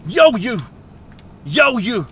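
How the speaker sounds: a light gruff Boston call; Boston accent. Retold or imitated. Camp owner hailing someone, lightly voiced Boston accent. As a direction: bark it lightly like a Boston tough guy. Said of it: "that's my Boston, um, impersonation".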